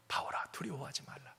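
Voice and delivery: 바울아 두려워하지 말라 breathed softly in a whisper; God whispering comfort to Paul. Acted. Whispered repeat of God's words.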